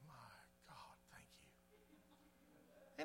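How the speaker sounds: very slow, voiceless whisper; whispered thanks. Retold or imitated. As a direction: whisper it like a secret prayer of relief. Retold thanks over ice cream.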